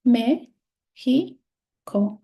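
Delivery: "Me, he, co" split into separate syllables with pauses. Shown as a demonstration. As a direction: break "Me, he, co" apart, one syllable at a time. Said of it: "is divided in three syllables".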